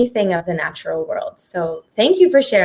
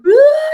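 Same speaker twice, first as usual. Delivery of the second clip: a sudden high, loud mock sob; imitated sob; 8 dB louder than usual. Remembering crying when asked how she was, imitated sob. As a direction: burst into a retold sob. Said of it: "and I would just cry".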